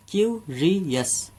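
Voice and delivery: "Curious" धीरे: "Q… रि… यस"; "curious" के हर सिलेबल को अलग करें; तीन हिस्सों में. Shown as a demonstration. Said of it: "इसे हमने तीन हिस्सों में बोला". ("क्यूरियस" सिखाते हुए तीन टुकड़ों में)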